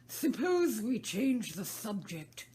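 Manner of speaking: slow, loud, lazy March Hare; a bored hare changing the subject. Acted. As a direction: drawl it out, half yawning.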